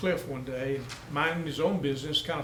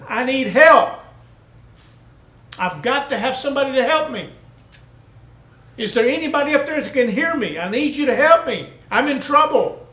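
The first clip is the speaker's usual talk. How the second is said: loud, high, desperate cries for help; desperate screaming; 10 semitones higher, 11 dB louder than usual. Acted. Man dangling from a cliff screams for help, desperate. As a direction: yell up the cliff for rescue. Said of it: "he began to scream".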